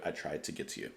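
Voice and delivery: hushed fading repeat of I tried to get to you; fading echo. Shown as a demonstration. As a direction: let it die away like an echo. Lyric line echoed, fading.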